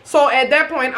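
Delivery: normal talking voice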